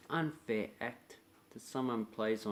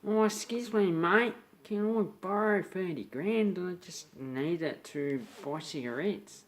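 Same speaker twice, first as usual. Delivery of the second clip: high, loud and slow wheedling ask; butter up a mate for a huge loan; cheeky borrower; 7 semitones higher, 4 dB louder than usual. Retold or imitated. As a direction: friend asking a mate for thirty grand, high and wheedling. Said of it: "you go".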